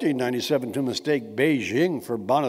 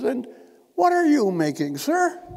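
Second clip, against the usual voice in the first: Queen's question; 8 semitones higher than usual. Retold or imitated. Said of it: "I cannot do her accent".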